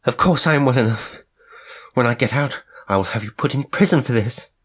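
high, loud, fast angry protest; furious outburst. Acted. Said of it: "she demanded".

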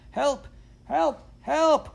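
high, yelping alarm cries; crying for help. A narrator's reading. Dog calling master back to window, crying for help. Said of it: "cried the dog".

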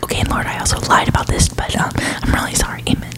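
soft, quick whispered prayer; ASMR whisper. Shown as a demonstration. Whispering a mock confession prayer for listeners. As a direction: whisper it like a private prayer to the Lord. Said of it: "that was a little A S M R for you".

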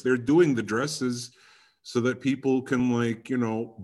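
ordinary delivery

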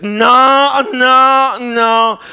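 high, loud, disgusted no's with a face like it stinks; stinky-face no. Shown as a demonstration. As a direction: wrinkle the nose and refuse. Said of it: "just make a face like it stinks".

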